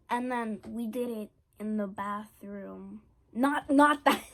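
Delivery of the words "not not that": higher, much louder, laughing correction; flustered laughing. Real, not acted. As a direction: blurt it out, embarrassed. Flustered by own double meaning.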